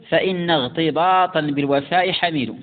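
ordinary talk, nothing marked

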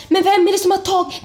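retold panic. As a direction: blurt it in alarm. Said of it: "jag får ju panik".

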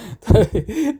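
high-pitched tipsy giggle. A narrator's reading. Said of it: "захихикал".

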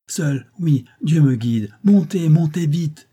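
loud, high, slowed urging; urgent plea. A narrator's reading. Maggie urging Tom into the boat, loud high urgent plea. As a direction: urge him aboard with all her strength.

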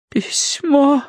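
high, thin, feeble plea; faint rustle. A narrator's reading. Mother clutching her niece, faint high plea. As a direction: breathe the word out weakly. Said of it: "прошелестела миссис Меринг".

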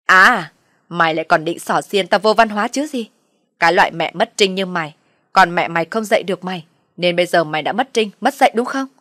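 loud shrieking tirade, building to the end; screaming rage. A narrator's reading. Mother-in-law screams at the daughter-in-law, building rage. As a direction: scream her down, beating her chest. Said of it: "Bà vỗ ngực đen đét mà lại gào lên".

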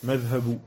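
madhabu with a bare final -u, no n; ending in -u. Shown as a demonstration. Teacher showing how his sheikhs pronounced it, ending in -u. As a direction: say madhabu, leaving the last vowel open. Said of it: "ils le prononçaient tous comme cela".

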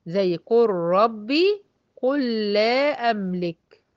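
very slow, lam merged into ra and lam; slow Quranic recitation; recitation with idgham. Shown as a demonstration. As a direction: recite قل ربي قل لا املك slowly, both merged. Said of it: "متى تدغم لام الفعل".

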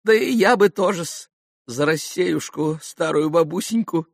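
high, wheedling little voice; tittering old hanger-on. A narrator's reading. Maximov joining the toast to Russia, tittering. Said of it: "подхихикнул Максимов".

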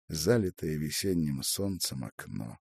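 usual speaking voice

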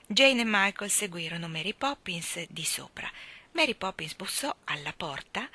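usual speaking voice

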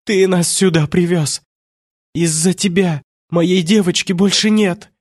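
high-pitched, anguished blame; acted hysterical reproach; shouted accusation. A narrator's reading. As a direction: hurl blame in a high, distraught voice. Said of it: "выкрикнула она".

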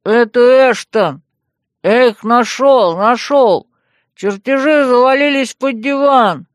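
loud, slow, hollow voice from a pipe; muffled voice through pipe. A narrator's reading. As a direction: call up through a speaking tube. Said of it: "Из трубы послышался глухой голос".

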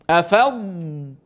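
أفض with a long drawn-out ض; held ض sound. Shown as a demonstration. Teacher to students, holding the ض so they hear it. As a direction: stretch the ض at the end of أفض. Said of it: "سامعين الصوت هذا؟".

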